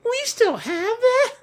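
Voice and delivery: very high, drawn-out question; high cartoon voice. Creator re-enacting a character's reply, high slow cartoon voice. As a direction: squeak out a slow, hammed-up question.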